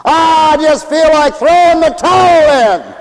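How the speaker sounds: hammed-up cry of surrender; exasperated giving up. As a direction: cry out as if ready to quit. Acts someone giving up.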